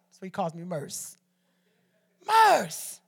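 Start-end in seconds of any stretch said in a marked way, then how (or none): 2.2-3.0 brother's call. Retold or imitated. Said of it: "this is how he talk".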